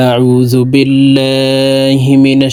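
slow, drawn-out melodic tilawat; Quranic recitation. Acted. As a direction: intone it slowly like a reciter. (ধীর সুরেলা তিলাওয়াত)

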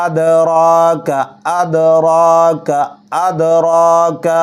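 আদরাকা with the d bounced clearly, slow; qalqala on dal. Shown as a demonstration. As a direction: bounce the d in আদরাকা each time. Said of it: "ডালের উপর কলকলা আসবে". (আদরাকা-তে ডালের উপর কলকলা)

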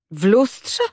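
a touch unsure, rising question. A narrator's reading. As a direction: lose a bit of firmness. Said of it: "powtórzyła już mniej stanowczym głosem chora".

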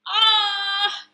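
loud, high, drawn-out wail; storybook shriek of dismay; horrified cry. Acted. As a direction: cry out as a shocked vegetable. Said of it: "cried Broccoli".

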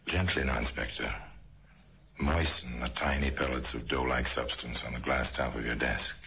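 ordinary delivery

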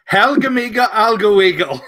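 hammed-up fake Swedish gibberish; high, loud mock Scandinavian voice; mock Swedish accent. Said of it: "can I do the voice".

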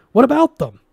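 high, loud cry of alarm; frightened scientist shouting; panicked shout. A narrator's reading. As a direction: shout it, pointing at the guards.

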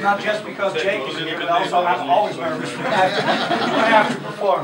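chuckling through stage nerves; quick, nervously laughing confession; nervous laughter. Real, not acted. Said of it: "double nervous at the moment".

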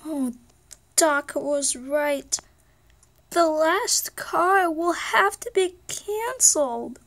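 dramatic lament. Pretend. Character announcing the last car is cancelled, slow dramatic lament. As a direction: draw it out like grim news.

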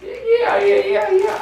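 squeaky rapid yeah yeah yeah; eager high yeahs. Retold or imitated. Old ladies answering him with squeaky eager yeahs. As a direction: chirp yes over and over like an eager old lady.

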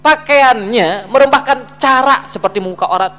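slow, loud, high sermon emphasis easing off; forceful preaching. Real, not acted. Preacher separates judging clothes from judging people, forceful preaching. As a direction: hammer out the distinction slowly, then ease.

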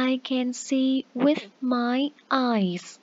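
put-on very slow, each word spaced out for children; slow, clear teacher demo; slow model sentence. Shown as a demonstration. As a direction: model it slowly for young learners. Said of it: "Thì con có thể nói là".

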